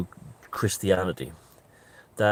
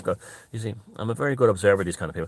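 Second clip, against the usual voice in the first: ordinary delivery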